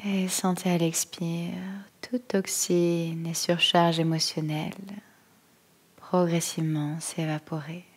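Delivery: calm, unhurried yoga nidra voice, long pauses; guided meditation. Acted. Yoga teacher settling listeners into stillness, calm guided-meditation voice. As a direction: guide them gently into stillness, phrase by phrase.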